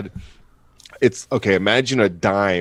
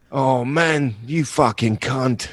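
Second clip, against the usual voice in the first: mock Aussie swearing; 4 semitones higher, slower than usual. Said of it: "That's what he said, just like that".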